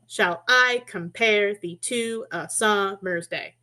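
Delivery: slow iambic beat, stressing "I", "-pare", "to", "sum-", "day"; deliberate da-DUM scansion on "Shall I compare thee to a summer's day"; stressed syllables. Shown as a demonstration. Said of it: "every stressed syllable is in every other word".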